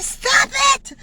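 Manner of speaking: high, loud imitated command; teacher's shout. Teacher orders three classes to stop chanting, sharp shout. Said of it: "And the teacher said".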